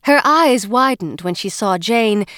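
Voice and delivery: ordinary delivery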